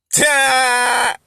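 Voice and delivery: short loud high yelp; sudden high cry; a quick high outburst. Real, not acted. Reacting to own obvious example.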